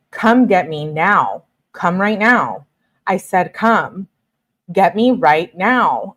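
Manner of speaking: normal talking voice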